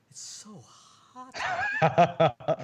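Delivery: slow, high, airy gasping at the heat; overheated, breathless complaint; worn out by heat. Acted. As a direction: drag it out like you're melting. Marvin sweating after being outside.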